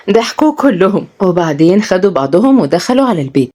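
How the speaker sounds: ordinary delivery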